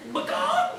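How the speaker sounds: a very high squawked bird call, macaw; a hammed-up chicken cry with a laugh.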